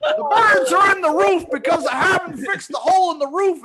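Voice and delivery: high, loud hammed-up Cosby voice, laughing; mock Bill Cosby. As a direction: play a creepy dad giving the talk. Voicing Bill Cosby to kids.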